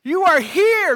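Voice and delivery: loud, high, slow proclamation; shout it out. Shown as a demonstration. Pastor showing how the original psalm is shouted, loud and slow. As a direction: proclaim it slowly at full voice. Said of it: "you have to shout it out and say".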